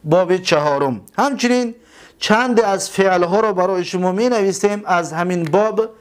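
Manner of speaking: ordinary talk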